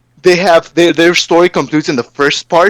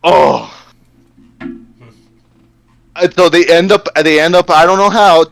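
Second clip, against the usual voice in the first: normal talking voice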